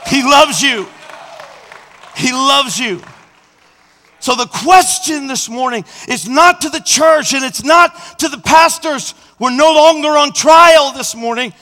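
loud, high, full-force altar call; preaching. Acted. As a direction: preach it out to the arena.

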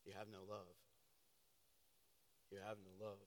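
quiet, grieved reproach; soft Jesus. Acted. Jesus gently rebuking the church, very soft. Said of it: "And Jesus said".

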